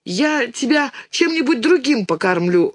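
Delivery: lightly voiced plaintive child. A narrator's reading. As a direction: plead with mama for forgiveness. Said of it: "жалобно говорит Динка".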